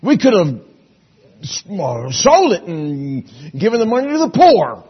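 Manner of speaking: high, loud, indignant gripe; griping Judas. Acted. Judas griping to the disciples about the waste, high and loud. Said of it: "Judas started griping".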